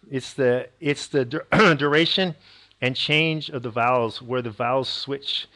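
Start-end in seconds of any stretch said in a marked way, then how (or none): none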